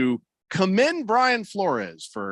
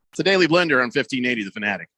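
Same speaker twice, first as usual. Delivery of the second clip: ordinary talk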